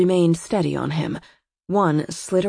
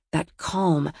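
ordinary delivery

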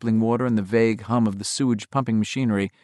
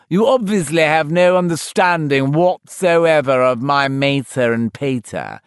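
much higher, slow posh butler voice; Toodles the Butler; 4 semitones higher, 5 dB louder than usual. Acted. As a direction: play a pompous English butler. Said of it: "in his Toodles the Butler voice".

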